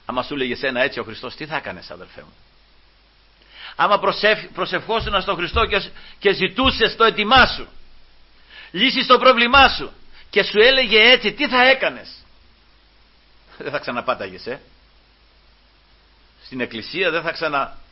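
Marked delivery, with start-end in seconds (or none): 3.8-12.1 challenging preaching. Acted. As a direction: build to a high, loud question.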